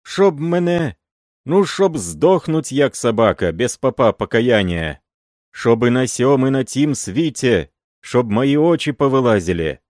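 loud, high curses on himself; swearing oath. A narrator's reading. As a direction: swear it to God at full voice.

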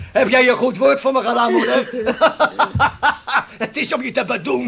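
scornful laugh, talking high through it; mocking laughter. Son laughing scornfully at the shipowner's claim of kindness. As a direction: laugh in his face while you speak. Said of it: "Lach jij daarom?".

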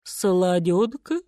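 slow, drawn-out elephant voice; sleepy elephant question. A narrator's reading. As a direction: ask slowly, just woken up. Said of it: "спросила она".

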